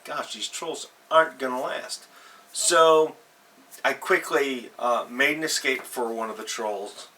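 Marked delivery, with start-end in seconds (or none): none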